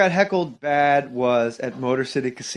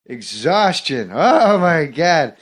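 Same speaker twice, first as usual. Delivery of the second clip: Norm Macdonald. Acted. Comic retelling his heckled casino bit, rambling impression. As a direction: ramble awkwardly, stretching the key words. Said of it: "I did it in the impression".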